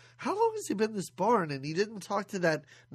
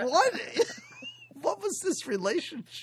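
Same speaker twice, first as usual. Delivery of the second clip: high, baffled laughing disbelief; mock outrage, much higher, breaking into a laugh; incredulous what; 11 semitones higher than usual. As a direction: squeal out your disbelief at the weird pairing. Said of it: "And I'm like".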